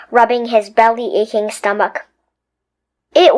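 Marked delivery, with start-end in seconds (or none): none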